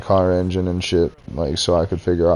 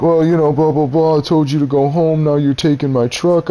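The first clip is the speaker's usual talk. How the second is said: much higher, whiny mock boss voice; 7 semitones higher than usual. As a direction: parrot his excuse back in a sneering put-on voice. Mocking the boss's reply.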